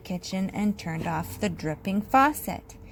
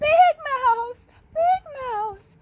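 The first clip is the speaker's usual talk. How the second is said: calling out high and scared; a frightened little mouse calling up high; high anxious calling; 7 dB louder, slower than usual. A narrator's reading. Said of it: "called Little Mouse".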